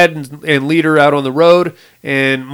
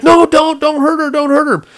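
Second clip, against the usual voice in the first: much higher desperate plea; dad begging the kidnapper, higher pleading voice; 11 semitones higher than usual. Retold or imitated. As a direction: beg it up high.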